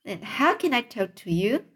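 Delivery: ordinary delivery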